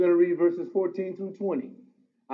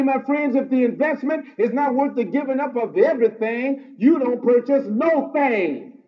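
raised, ringing pulpit voice, building to a shout on the key lines; full-force preaching; 7 semitones higher, 4 dB louder than usual. Acted. Pastor exhorting his congregation, preaching at full force. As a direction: preach it hard and lift the room.

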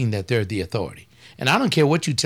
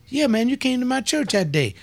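mock pleasantry; 11 semitones higher than usual. Imagined conversation with Tone X.

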